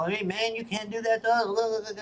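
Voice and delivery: high, pleading mock voice of a migrant; mocking a migrant pushing back, pitched high; mock migrant plea. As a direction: squeak it out like a protesting migrant. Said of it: "they're gonna hustle".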